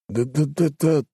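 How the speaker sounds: stammering through chattering teeth. Acted. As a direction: teeth knocking from fear. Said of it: "стуча зубами".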